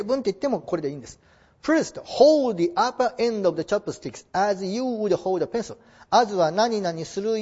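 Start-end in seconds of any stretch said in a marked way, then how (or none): 1.6-5.8 slow model reading. Shown as a demonstration. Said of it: "model reading English sentence".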